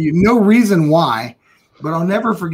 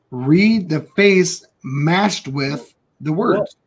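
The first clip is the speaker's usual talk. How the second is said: 1 slow emphasis; much slower than usual. Real, not acted. Slow emphatic lesson point.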